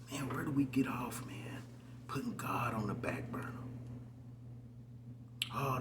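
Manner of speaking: hushed, grieving whisper; breathy sorrowful hush; sad whisper. Real, not acted. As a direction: whisper it like it hurts to say. Grieving rant opened in whisper.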